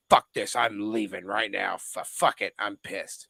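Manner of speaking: low mock-angry grumble; imitating angry friend. Friend storming off after losing his wallet, low mock grumble. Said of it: "he decides that".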